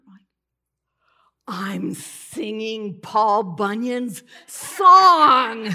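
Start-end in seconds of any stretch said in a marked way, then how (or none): 1.5-5.7 loud, drawn-out incredulous cry; hammed-up outraged astonishment; exasperated disbelief. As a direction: stretch and belt out the last word. Startled to catch herself singing it.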